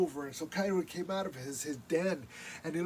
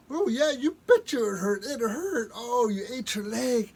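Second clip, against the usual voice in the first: mock crying; 5 semitones higher, 7 dB louder than usual. Raven crying about pain.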